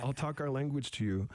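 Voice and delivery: a hint of the teasing elder's voice; lightly imitated teasing elder. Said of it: "she actually made fun of me".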